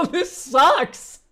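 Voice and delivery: high, loud laugh around the words. Real, not acted. Streamer cracks up reading the AI roast, laughing hard. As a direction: laugh it out in disbelief.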